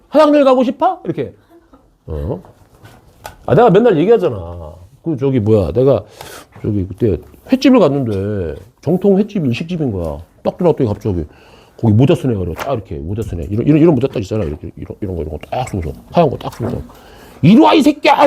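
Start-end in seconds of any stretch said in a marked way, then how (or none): 0.1-1.0 high-pitched echo of a misheard question. Retold or imitated. Teacher showing what he misheard from a student, high questioning echo. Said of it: "왜 얼핏 들리면 그렇게 들려?". 17.4-18.2 high, loud mock-abusive yell; loud shouted greeting. Teacher retelling a sushi chef's misheard welcome, loud high shout. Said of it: "그걸 큰 소리로".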